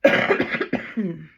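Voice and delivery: loud hacking cough; coughing fit. Real, not acted. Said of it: "I'm sorry I'm coughing so much".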